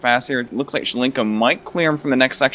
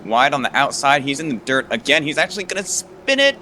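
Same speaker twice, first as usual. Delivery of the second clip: excited; 6 semitones higher than usual. Real, not acted. Excited live race call.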